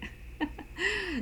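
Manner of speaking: a light high-pitched laugh; quick amused giggle. Real, not acted. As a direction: laugh at your own line. Laughs at own example.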